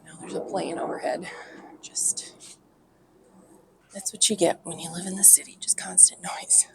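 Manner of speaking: soft unvoiced whisper; hushed aside while a plane passes. Real, not acted. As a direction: whisper it so the mic barely catches you. Whispering over plane noise.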